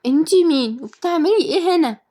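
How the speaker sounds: put-on high-pitched child voice; squeaky, challenging kid; curious little girl. A narrator's reading. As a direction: demand an answer like a small child. Child questions the stranger.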